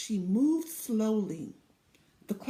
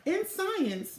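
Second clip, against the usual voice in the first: plain reading voice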